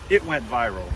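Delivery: ordinary talk, nothing marked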